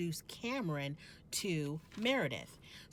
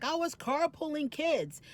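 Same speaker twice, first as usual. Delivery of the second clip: higher, louder mock whiny excuse; mocking Whitney; 9 semitones higher, 4 dB louder than usual. Whitney brushing off Mary's invite, mock higher voice. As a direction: toss off the excuse in a put-on higher voice. Whitney mocked in retold argument.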